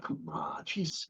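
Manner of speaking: breathy whisper of exasperation; soft whispered come on; whispered aside. Real, not acted. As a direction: drop to a whisper, half amused. Exasperated aside after joke.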